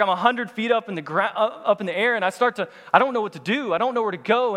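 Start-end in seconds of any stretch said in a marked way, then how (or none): 2.9-4.6 higher voice in mild mock panic; retold panic. Climber recalling being stuck a hundred feet up, mock panic. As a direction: play up being lost and helpless.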